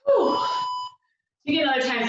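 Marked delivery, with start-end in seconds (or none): 0.1-0.4 a loud, high gasp of relief after the set; real winded whew. Real, not acted. Instructor catching her breath after fast feet, winded whew. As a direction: blow out hard, spent from the squats.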